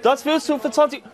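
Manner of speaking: market crier. Acted. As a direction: sell fish like a Marktschreier. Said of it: "ich habe die Leute erschreckt".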